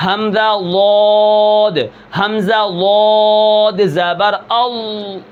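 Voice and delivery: اَض said very slowly, a glottal hamza then ض held and stopped; slow articulation drill, hamza then stopped ض; hamza-dhad drill. Shown as a demonstration. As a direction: say حمزہ واد slowly, the sound halting at the ض articulation point. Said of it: "پیچھے ہمزہ لگاؤ، آخر میں اس حرف کو پڑھو".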